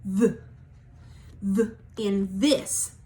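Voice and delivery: strong voiced th demo. Shown as a demonstration. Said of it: "the louder sound".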